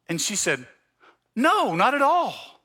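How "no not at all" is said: church lady. Retold or imitated.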